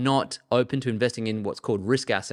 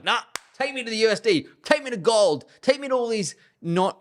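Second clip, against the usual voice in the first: high, breezy voice of a skittish investor waving off the Aussie dollar; mock investor voice; 9 semitones higher than usual. Commentator mimics investors fleeing to the US dollar, high mock voice. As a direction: play a nervous trader bailing out, pitched up and dismissive.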